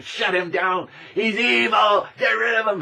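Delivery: high, loud mock outrage; mocking outraged mob. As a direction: ham up the angry crowd shouting them down. Mob voice labelling a truth-teller.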